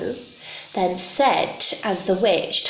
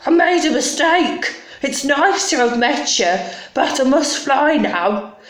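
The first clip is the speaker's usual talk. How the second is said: higher-pitched, nervous apology; flustered dragon; 6 semitones higher, 3 dB louder than usual. Acted. Dragon backs away from the beast, high flustered voice. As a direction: splutter excuses and get away. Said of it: "he started to shake".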